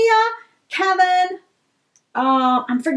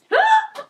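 sharp high gasp; quick delighted intake of breath; excited gasp. Real, not acted. Seeing a new item.

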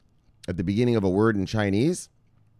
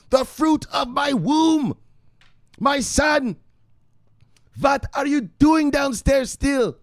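high, slow, loud mock-mother cry; hammed-up doting mother; 5 dB louder, much slower than usual. Mock mother calling to the precious son, hammed-up adoration. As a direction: draw out each endearment in a high put-on voice.

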